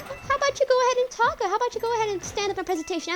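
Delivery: high, taunting impression of the teacher calling him out; mocking teacher. Streamer mimics his teacher calling him out in class, high and taunting. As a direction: sneer it out like a bullying teacher. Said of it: "be like, be like".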